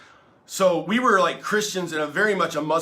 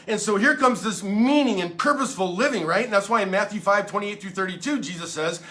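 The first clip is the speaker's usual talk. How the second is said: usual speaking voice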